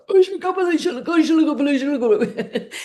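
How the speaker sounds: high, loud mock outburst; hammed-up tantrum; angry rant. Shown as a demonstration. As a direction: vent fake anger loudly. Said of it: "angry gibberish".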